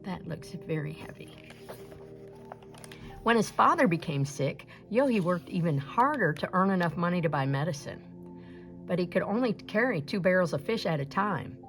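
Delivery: ordinary delivery, nothing marked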